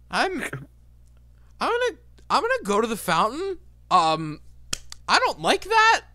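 very high, loud, frantic squeal; a high, loud, alarmed dude; rising panic. Acted. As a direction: bolt for the fountain in a shrill panic. Dude panics, rushes to fountain.

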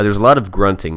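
normal talking voice

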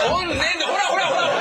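high, laughing "look look"; gleeful jeering; excited pointing. Retold or imitated. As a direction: crow it with glee.

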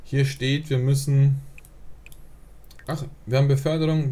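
ordinary delivery

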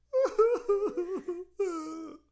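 pretend cry. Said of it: "Could you do a pretend cry?".

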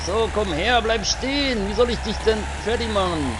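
high, loud chasing taunt; high excited taunting. Real, not acted. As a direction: call after the fleeing target. Chasing car in game.

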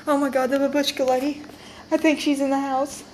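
normal talking voice

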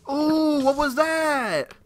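high, loud, drawn-out cry of alarm; startled outcry. Real, not acted. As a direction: shout it in sudden shock. Startled by something in game.

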